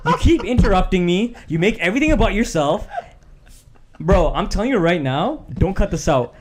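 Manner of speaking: play-fight with your co-host, loud; mock angry rant.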